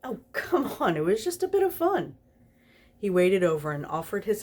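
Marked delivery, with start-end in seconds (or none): 0.0-2.2 much higher, coaxing and playful; Crowley coaxing the grumpy angel, playful and high; playful demon. Acted. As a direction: wheedle the angel into laughing.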